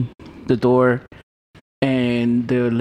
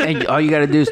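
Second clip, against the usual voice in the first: normal talking voice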